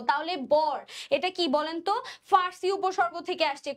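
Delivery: normal talking voice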